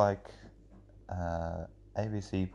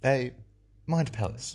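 higher, louder, halting presenter voice; ABC podcaster; 5 semitones higher, 5 dB louder than usual. Shown as a demonstration. Demonstrating how ABC podcasters talk, mannered halting voice. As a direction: parody a public-radio podcaster, breaking up the phrases. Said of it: "a demonstration of how an A B C podcaster talks".

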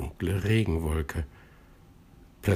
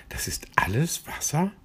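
amazed whisper; 8 semitones higher than usual. A narrator's reading. Amazed question.

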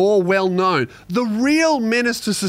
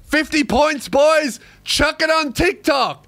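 higher-pitched mock private school kid; imitated schoolboy; 8 semitones higher than usual. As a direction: crow it like a boy egging on his mates. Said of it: "that kid comes out the other end and goes".

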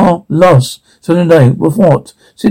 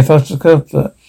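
normal talking voice

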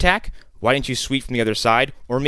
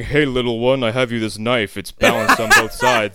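villain impression; 3 dB louder than usual. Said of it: "He's like".

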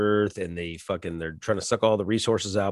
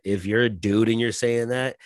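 ordinary delivery

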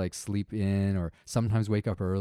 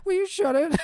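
put-on high, squeaky little kid copying; parrot voice; 4 dB louder than usual. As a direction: parrot it back in a tiny voice. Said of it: "this little parrot voice".

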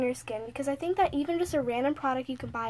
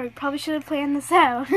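usual speaking voice

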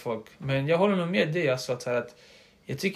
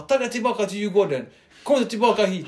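raised, higher mock coach bark; imitated coach yelling; 4 semitones higher, 4 dB louder than usual. Coach sending a player back to Djurgården, imitated yelling. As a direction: throw someone out like the coach. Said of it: "han sa flera gånger till en viss snubbe".

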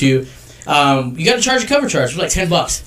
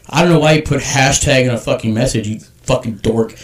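ordinary talk

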